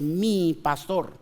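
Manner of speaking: slow "mi pastor", "mi" drawn out and pressed; stress on mi. Shown as a demonstration. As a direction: linger on "mi". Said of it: "es diferente cuando usted dice".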